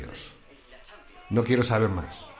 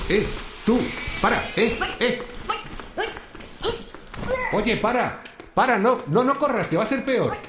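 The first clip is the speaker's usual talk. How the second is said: calling after someone; 11 semitones higher than usual. Acted. Chasing an intruder, calling out.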